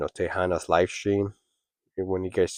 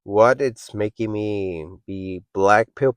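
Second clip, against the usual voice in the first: ordinary delivery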